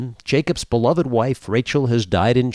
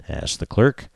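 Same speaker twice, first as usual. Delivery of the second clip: ordinary delivery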